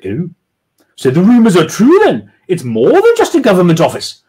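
posh lady prying. Acted. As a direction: fish for gossip. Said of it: "doing women's voices".

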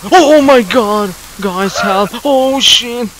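sudden high, loud cry for help; real fright, shouting for help; panicked scream. Real, not acted. Attacked in horror game, panicking.

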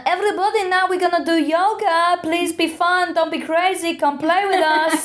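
very high, pushy rep in a bad accent; mock an over-keen entertainer rallying in accented English; accented resort rep. Rep trying to get sunbathers into yoga, high accented cheerleading. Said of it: "that's a very bad accent".

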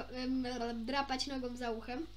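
usual speaking voice

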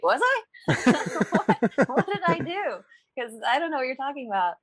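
high, puzzled retold reply; mock bafflement. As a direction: play confused at the interviewer. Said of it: "And I'm like".

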